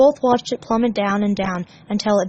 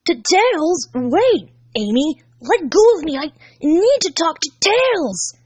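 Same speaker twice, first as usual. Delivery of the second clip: louder, higher, pleading shout; urgent call; 8 semitones higher, 4 dB louder, slower than usual. Acted. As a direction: call after him in a panic. Sonic pulling away, calling.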